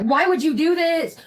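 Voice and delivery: high, drawn-out mock wail; slow, high-pitched reproach, hammed up; imitating upset. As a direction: mimic someone wailing in dismay. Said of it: "Doug was so upset".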